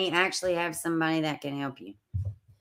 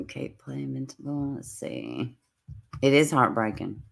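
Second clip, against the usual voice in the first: ordinary delivery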